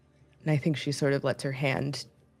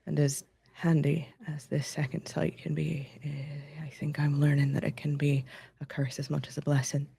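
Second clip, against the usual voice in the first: soft, hushed confession with long pauses; intimate whisper; 4 dB quieter than usual. Acted. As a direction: murmur it close into their ear. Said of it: "as you whisper that into".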